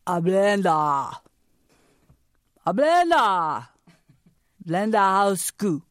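put-on slow Chinese-accented Blender House School, each word weighed; Chinese-accented grandpa. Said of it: "look me in the eye and say".

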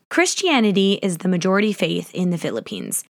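usual speaking voice

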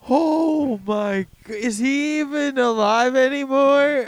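high, loud horrified cartoon gasp; cartoon shock. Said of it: "your friend just got hit by a car".

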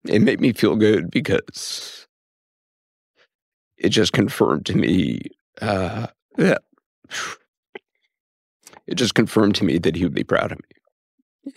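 real halting, tearful delivery with long pauses; fighting tears. Real, not acted. Son moved by his late father's recording, fighting tears. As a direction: stop and restart while holding back crying. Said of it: "you don't cry with anyone else".

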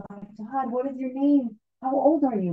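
high-pitched, astonished questions; pitched well up, imitating an awestruck questioner; stunned disbelief. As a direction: voice rises in amazement, retelling the rabbi's shock. Rabbi kneels before living child.

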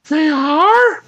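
high, surprised lady; high woman voice. Acted. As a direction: gasp it like a lady.

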